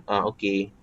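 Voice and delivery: low, soft, half-hearted "okey"; reply as if you can't be bothered; reluctant reply. Shown as a demonstration. Teacher imitating someone who barely wants to reply, reluctant. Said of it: "macam nak tak nak je reply".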